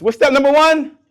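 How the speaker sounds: loud, high call-out to a crowd; rousing quiz call. Real, not acted. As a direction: fire the question out for the audience to answer. Quizzing the audience, call and response.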